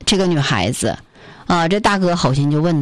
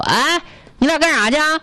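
a big Northeast brother's voice, higher and hearty; Northeast accent; 7 semitones higher than usual. Retold or imitated. Big Northeast brother calls out to the pair, in Northeast accent. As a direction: Northeast accent, calling out to two strangers. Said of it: "操着一口这个东北口音的大哥".

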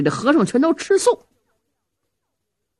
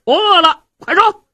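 impatient shout; 8 semitones higher, 5 dB louder than usual. Acted. Impatient hungry Zhao Hu.

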